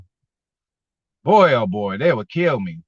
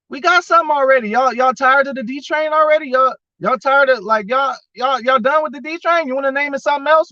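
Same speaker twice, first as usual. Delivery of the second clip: excited teasing; 8 semitones higher than usual. Real, not acted. Teasing fans about the nickname.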